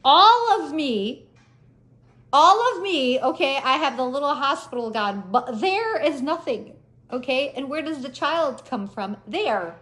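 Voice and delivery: high, loud, slow exasperated explaining; emphatic explaining. Retold or imitated. Telling her mom why the scarf is off, exasperated. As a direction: spell it out to your mother, slowly.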